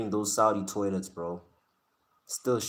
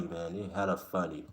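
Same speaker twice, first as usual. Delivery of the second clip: ordinary talk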